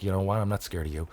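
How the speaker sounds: dropped low and soft, a mild threat; low quiet taunt. Retold or imitated. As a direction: say it under your breath, unafraid. Ash's point retold.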